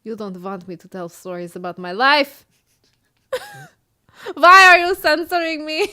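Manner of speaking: put-on Middle Eastern fortune teller accent. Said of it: "The accent just got all messed up".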